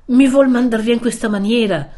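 imploring not to be sent away; pleading young man. Acted. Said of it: "in atto supplichevole". (supplichevole, con voce che sale)

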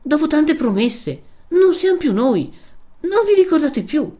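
high, loud, wounded protest; hurt, emphatic remonstrance; impassioned reproach. A narrator's reading. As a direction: plead indignantly, pitch pushed right up. Renzo reproaches Lucia over promises.

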